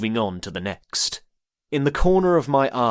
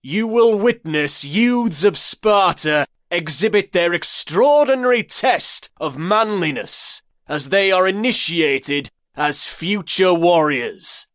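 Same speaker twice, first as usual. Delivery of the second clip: loud commanding; 8 semitones higher, 5 dB louder than usual. A narrator's reading. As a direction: announce it loud and commanding to the crowd. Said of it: "he said in a loud, commanding tone".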